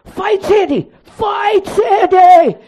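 very high, drawn-out yell; screaming. Retold or imitated. Brother-in-law yelling at her through the curtain, long high shout. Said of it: "Screaming and yelling".

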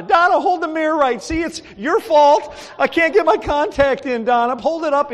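loud, high, exasperated blame; hammed-up outburst at a helper; mock blaming. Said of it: "I'm blaming Donna".

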